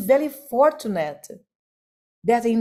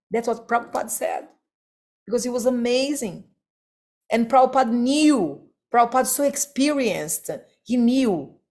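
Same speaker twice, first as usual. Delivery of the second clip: ordinary delivery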